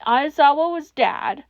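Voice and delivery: ordinary delivery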